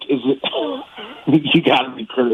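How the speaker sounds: usual speaking voice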